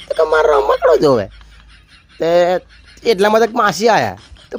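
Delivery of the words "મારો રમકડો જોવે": put-on very high, whiny kid's voice; little boy whining for his toy; whiny little child. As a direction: squeak it out like a crying toddler. Crying little boy wants toy.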